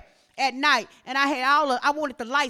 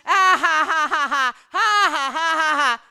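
loud, drawn-out fake "ha ha ha"; mocking laughter; 2 semitones higher, 6 dB louder than usual. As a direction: imitate scoffers laughing at a warning. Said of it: "Laugh at, at what you say".

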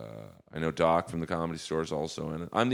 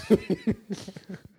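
a high burst of laughter; real amused laugh. Real, not acted. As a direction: laugh high and bright at your own line. Laughs at own joke.

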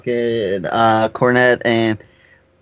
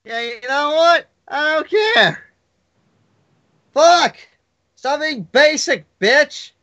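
high, cracked heckler voice, hammed up; cranky old-man heckler; 13 semitones higher than usual. Critic telling off a basic filmmaker, high mock-cranky rant. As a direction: heckle like a crotchety old puppet. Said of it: "two old men from Sesame Street".

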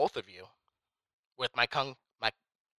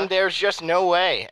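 loud, slow gloating boast; taunting; 8 semitones higher, 9 dB louder, slower than usual. Acted. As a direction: rub it in, loudly. Bragging, taunting rival.

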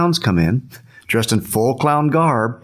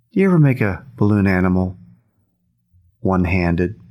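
slow challenge with a long pause; deliberate, unhurried question; real slow; 3 semitones lower than usual. Retold or imitated. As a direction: draw it out, then pause before the last phrase. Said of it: "he says it just like that, real slow".

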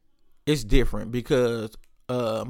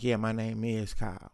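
soft, subdued imitation of a shaken man; retold crestfallen reply; 3 semitones lower, 9 dB quieter than usual. Brother caught out admitting his name, softly subdued. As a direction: quietly admit it, a little deflated. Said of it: "you could tell T was fucked up".

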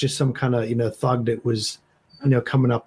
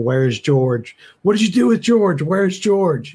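demanding, higher-pitched mock shout; raised, urgent questioning at a held-back volume; acted shouting; 6 semitones higher, 3 dB louder than usual. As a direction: demand answers in a half-volume yell. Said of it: "start shouting".

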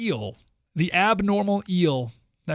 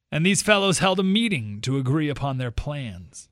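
ordinary talk, nothing marked

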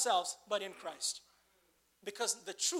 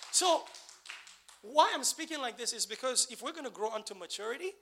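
ordinary talk

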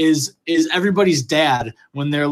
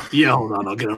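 lower, mumbled imitation of a tired dad; mock gruff dad; 6 semitones lower, faster than usual. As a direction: grumble it into the phone like a worn-out father. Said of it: "he'd be like".

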